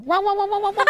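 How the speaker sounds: high imitated barking; mock dog barks. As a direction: bark like a dog chasing a car. Dogs chasing a taxi.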